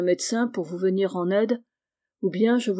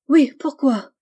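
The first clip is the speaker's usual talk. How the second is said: higher, louder sudden cry; urgent outburst with raised pitch; uncontained exclamation; 6 semitones higher, 7 dB louder than usual. A narrator's reading. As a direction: blurt the question out, unable to hold it back. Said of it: "s'écria Charlotte, incapable de retenir cette exclamation".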